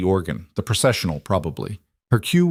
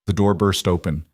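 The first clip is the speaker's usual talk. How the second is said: ordinary delivery, nothing marked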